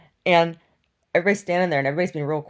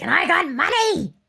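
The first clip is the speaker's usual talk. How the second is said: much higher boastful put-on voice; shrill imitation; 12 semitones higher, 5 dB louder than usual. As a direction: brag it like the crazy woman. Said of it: "she's like".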